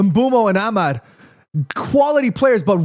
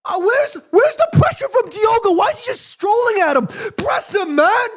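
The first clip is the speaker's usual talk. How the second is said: high, pitched-up angry shouts at the screen; exasperated shouting; 13 semitones higher than usual. Real, not acted. Frustrated fan yelling at Diogo on TV, high angry shout. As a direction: yell at the player to press, fed up. Fan furious at lazy pressing.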